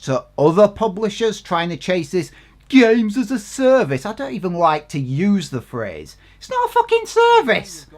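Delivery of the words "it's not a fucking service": angry outburst. Real, not acted. Rant about games as a service.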